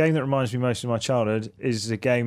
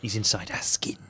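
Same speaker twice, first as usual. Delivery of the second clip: creepy whisper; 3 semitones lower, 6 dB quieter than usual. Pretend. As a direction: whisper it like a ghost story. Spooky joke about the sabotaging host.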